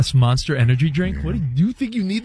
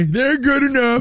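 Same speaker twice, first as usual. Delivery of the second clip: high, slow intro-voice imitation; mock show intro; 7 semitones higher, slower than usual. Cohost quoting the show intro, mock announcer. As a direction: drawl it like a cheesy show intro. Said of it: "Doesn't our show intro say".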